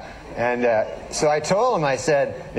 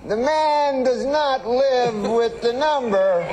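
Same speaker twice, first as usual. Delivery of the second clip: high, slow, stretched Cosby voice; Bill Cosby impression; 8 semitones higher, slower than usual. Cosby reporting the phone call to the fan, slow and high. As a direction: lay it out slowly like Cosby. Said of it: "He has that funny way of talking".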